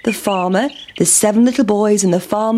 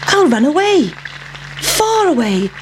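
high, swooping pancake voice; determined pancake; 7 semitones higher than usual. Acted. Pancake planning its escape, determined. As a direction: resolve to escape, pitched up high.

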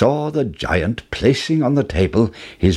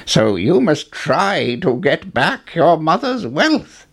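put-on old man voice; 7 semitones higher than usual. A narrator's reading. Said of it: "the old man continued".

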